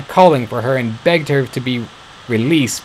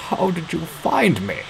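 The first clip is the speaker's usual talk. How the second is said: put-on a frail old voice, softer; weak, trembling question; shaky, softer; 6 semitones higher, 6 dB quieter than usual. A narrator's reading. Sick father asking his daughter, shaky and soft. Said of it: "asked her father shakily".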